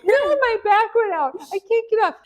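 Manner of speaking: high, loud desperate plea; pained plea. Retold or imitated. Stuck mom to the UPS man, high loud pained plea. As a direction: beg for help while stuck. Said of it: "She goes".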